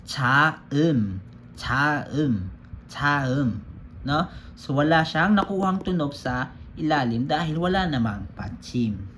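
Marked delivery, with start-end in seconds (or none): 0.1-3.6 Cha-um said as one syllable, cham with no final consonant; teacher models Cha-um as cham; Korean word read slowly. Shown as a demonstration. Teacher models a Korean word to the class, slow and clear. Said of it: "babasahin na lang natin siya as it is".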